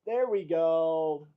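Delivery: slow, drawn-out triumph. Real, not acted.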